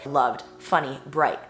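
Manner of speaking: ordinary delivery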